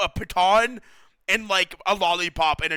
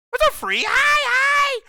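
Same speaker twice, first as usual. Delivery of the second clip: high, loud squeal of hello; excited greeting; 5 dB louder, slower than usual. Real, not acted. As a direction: greet a friend with a happy yelp.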